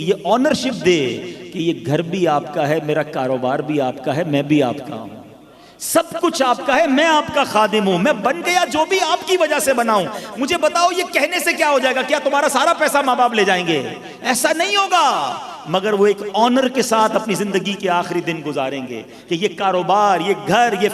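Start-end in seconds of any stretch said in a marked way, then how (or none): 5.8-15.4 impassioned preaching. Acted. Preacher voices a son's devotion, rising.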